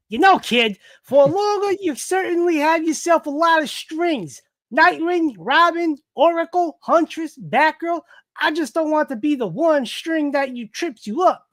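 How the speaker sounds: high cartoon villain voice; put-on villain, high and hammy; Joker. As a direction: pitch it up as a comic-book villain. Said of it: "All right, Joker".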